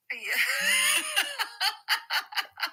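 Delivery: big high laugh. Real, not acted. Reacting to poll size.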